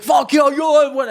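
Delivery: high, slow, startled yell in disbelief; shocked shout. Retold or imitated. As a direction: shout it, stunned by the news. [name] shocked, shouting on phone.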